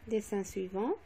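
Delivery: ordinary talk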